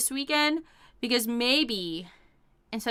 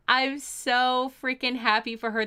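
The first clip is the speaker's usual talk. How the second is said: ordinary delivery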